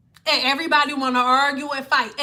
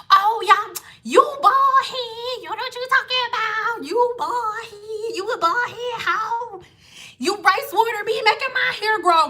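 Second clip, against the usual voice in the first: mocking critic voice; 7 semitones higher than usual. Vlogger imitating fans who taunted her, high and mocking. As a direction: taunt her like a smug hater.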